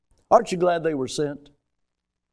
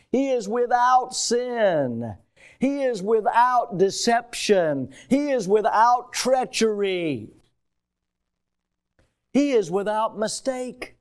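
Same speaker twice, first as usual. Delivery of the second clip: slow, higher litany, each phrase falling; emphatic preaching; 6 semitones higher, slower than usual. Real, not acted. Preacher declaring the Spirit's purity to the church, slow emphatic preaching. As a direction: proclaim each phrase slowly and deliberately.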